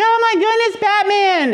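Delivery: high-pitched mock amazement; gushing grandma. Grandma greeting her costumed grandson, gushing in a high voice. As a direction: play along, thrilled to see a superhero. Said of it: "she's grandma, she's like".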